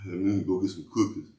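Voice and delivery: mock the rapper's way of talking, just a touch. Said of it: "making fun of how he talked".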